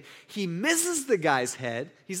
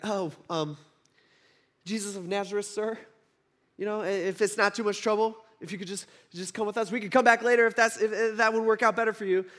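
meek and timid; 3 semitones higher than usual. Retold or imitated. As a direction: imitate a nervous underling asking a favour. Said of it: "in a lot more meek, timid way".

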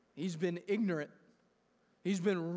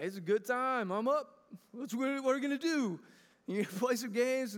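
lightly voiced eager toddler, a bit higher; little boy voice; 4 semitones higher than usual. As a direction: play a wide-awake three-year-old who is ready to play. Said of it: "He's like".

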